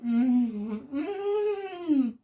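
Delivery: big delighted mm; rising yummy mm. Real, not acted. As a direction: hum climbing high with pleasure. Savouring food, rising hum.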